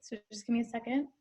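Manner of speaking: choked up and quiet, fast; fighting back tears; teary. Real, not acted. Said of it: "that always makes me tear up".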